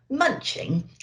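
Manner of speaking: ordinary delivery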